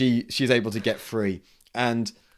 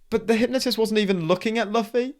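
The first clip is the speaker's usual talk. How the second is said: puzzled onlookers; 10 semitones higher, faster than usual. Retold or imitated. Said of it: "scratching their heads like".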